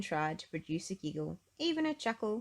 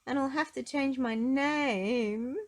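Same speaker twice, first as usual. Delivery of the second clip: slow, high, louder mock sob; sobbing; 7 semitones higher, 5 dB louder, slower than usual. Miss Giggles sobbing over her lost giggle, slow and high. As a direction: sob it out slowly in a little girl's voice. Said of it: "she sobbed".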